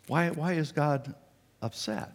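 usual speaking voice

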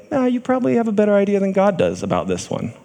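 high-pitched voice of temptation; tempting voice. Acted. Tempter suggesting to Adam and Eve they know better, high tempting voice. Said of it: "listened to the voice that said".

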